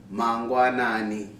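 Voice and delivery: Mangwanani said slowly, syllable by syllable; slow Shona word. Shown as a demonstration. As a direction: spell out Mangwanani gently for learners. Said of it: "which translates to".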